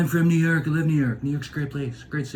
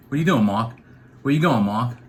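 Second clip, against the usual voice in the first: put-on R-less East Coast accent, "Mark" as "Mahk". Folks back home calling Mark over, East Coast accent. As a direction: talk like East Coast neighbours, dropping R's. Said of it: "'Cause where I grew up, that's what everyone called me".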